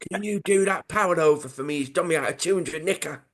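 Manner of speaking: high-pitched comic threatening heavy; Charlie. Acted. Charlie sending his mates after Powell, in a put-on thug voice. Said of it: "in what sort of voice".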